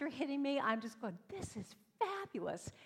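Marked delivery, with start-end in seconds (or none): 1.3-2.7 high, squealed delight without extra volume; starstruck delight. Retold or imitated. Girl on stage next to her idol, starstruck delight. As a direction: gush it, pitched way up.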